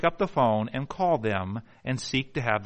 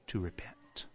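much softer whisper; hushed plea to repent; 8 semitones lower, 16 dB quieter than usual. Real, not acted. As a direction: let it fall to a whisper. Solemn point on repentance.

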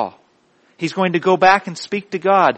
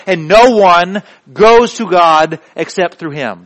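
loud, raised, building litany; preaching at full force; 4 semitones higher, 10 dB louder than usual. Real, not acted. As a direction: drive each title home, building to a peak.

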